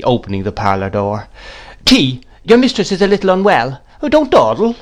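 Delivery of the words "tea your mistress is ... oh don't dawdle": high, brisk orders to a servant; calling out. A narrator's reading. Miss Betsy orders tea from Peggotty, commanding. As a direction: order her about, pitched up. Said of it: "cried Miss Betsy".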